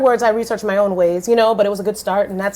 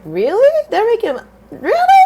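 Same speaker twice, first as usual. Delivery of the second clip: squeaking high in disbelief; disbelieving surprise; 13 semitones higher, 4 dB louder than usual. Retold or imitated. Narrator replaying her own disbelief to her friend, squeaky incredulous. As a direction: climb way up in pitch, incredulous.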